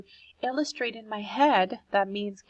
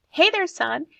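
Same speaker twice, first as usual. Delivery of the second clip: higher, louder friendly call from dad; cheerful father calling hello from the street; 8 semitones higher, 6 dB louder than usual. Acted. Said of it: "he called out".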